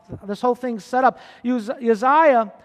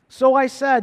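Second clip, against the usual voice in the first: ordinary talk, nothing marked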